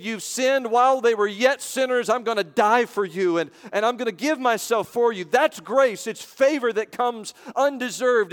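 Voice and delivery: high, driving, emphatic sermon voice; full-force preaching. Real, not acted. Preacher proclaiming God's undeserved favor, at full force. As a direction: proclaim it with mounting conviction.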